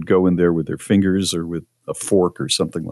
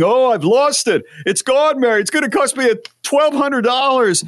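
high-pitched frantic wail about a loss; exaggerated despairing cry to a spouse; mock panic. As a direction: overdo the panic, voice shooting right up. Retelling his panic over lost phone.

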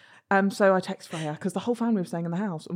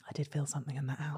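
softer, fast whispered confession; imitated whisper; 4 semitones lower, 11 dB quieter, faster than usual. Mum admits she felt ghost, hushed whisper. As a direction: breathe it like a secret.

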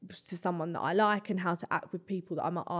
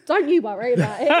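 mock flash charmer; 3 semitones higher, 8 dB louder than usual. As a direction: play the flash big spender reassuring her. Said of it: "It's giving".

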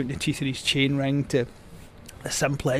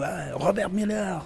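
slow, higher mock French accent; Belgian-French accent; 5 semitones higher, 4 dB quieter than usual. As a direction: draw out the name like a French journalist. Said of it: "I'll stop the accent now".

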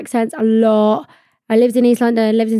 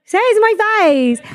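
defiant call-out; 9 semitones higher than usual. Real, not acted. Taunting nasty commenters.

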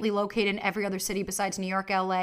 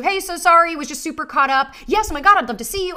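higher, louder, bubbly apology imitation; bubbly texter; 6 semitones higher, 6 dB louder than usual. Host imitating a busy date's upbeat text, bubbly and high. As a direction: chirp it like an excited texter apologising. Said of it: "and then they text you".

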